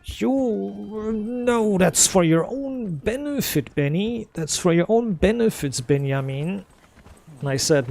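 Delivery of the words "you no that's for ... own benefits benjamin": higher, drawn-out mocking granddad voice; put-on high elder's voice, stretching the words; excuse-making granddad. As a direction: imitate a granddad pleading his excuses. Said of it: "my granddad was making up excuses".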